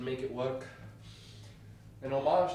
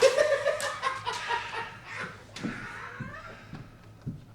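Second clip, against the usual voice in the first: breathy laugh. Real, not acted.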